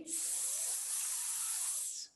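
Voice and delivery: "s" as a long sustained hiss; isolated S sound. Shown as a demonstration. Teacher showing the other sound of C, long voiceless "s". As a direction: hold "s" with no vowel. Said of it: "é o som do S".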